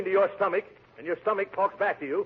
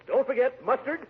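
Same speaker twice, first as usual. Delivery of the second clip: high, eager rattling off of condiments; gleeful greedy list; 9 semitones higher than usual. Acted. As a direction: savour every item with relish. Greedy ordering listing condiments.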